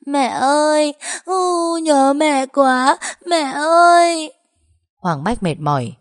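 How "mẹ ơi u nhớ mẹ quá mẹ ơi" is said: put-on high, slow, loud wailing boy; a boy breaking down crying for his mother; loud sobbing wail, each word drawn out; bursting into sobs. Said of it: "khóc giống lên".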